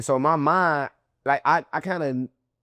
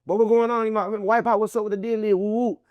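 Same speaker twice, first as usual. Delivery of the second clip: high, hyped calls with woo woo; edgy challenge; 8 semitones higher than usual. Retold or imitated. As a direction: square up to them, keyed up. Confronting the men, retold.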